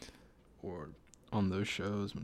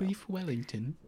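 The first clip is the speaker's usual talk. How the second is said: higher, hammed-up chef voice saying "Beef Wellington" with a rising end; mock celebrity chef; 8 semitones higher than usual. Joking about Gordon Ramsay's dish, hammed-up TV chef voice. Echoing the chef's signature dish.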